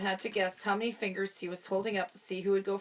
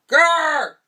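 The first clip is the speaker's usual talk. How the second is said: loud high growl; 7 semitones higher, 15 dB louder than usual. A narrator's reading. Slinky Dog growls before stomping the pump. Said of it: "He said".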